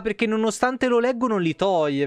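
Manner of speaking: ordinary delivery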